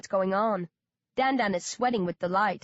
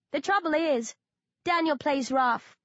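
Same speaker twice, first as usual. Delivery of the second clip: cynical; 7 semitones higher than usual. A narrator's reading. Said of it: "smiling cynically".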